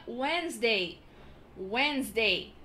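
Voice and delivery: Wednesday said as Wenzday; silent d. Shown as a demonstration. Teacher modelling Wednesday without the d. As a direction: drop the d in Wednesday. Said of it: "esse D não é pronunciado".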